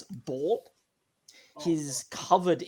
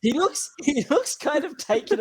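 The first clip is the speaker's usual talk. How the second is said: laughing; 11 semitones higher, 7 dB louder than usual. Real, not acted. GM laughing through reply.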